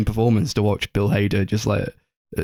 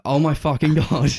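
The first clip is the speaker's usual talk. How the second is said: retold shock; 6 semitones higher than usual. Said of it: "it's like".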